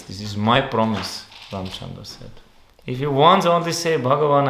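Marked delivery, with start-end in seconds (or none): 2.9-4.5 fervent exhortation. Real, not acted. Preacher urging the devotees.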